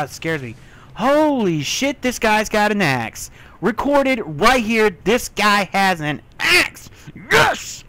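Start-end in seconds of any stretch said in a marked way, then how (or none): 0.9-6.9 loud, high thrilled shouting; real gamer excitement, much louder and higher; excited yelling. Real, not acted. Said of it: "So that's why I'm all excited". 7.3-7.8 high loud cheer; triumphant shriek; excited yes. Real, not acted. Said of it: "So that's why I'm all excited".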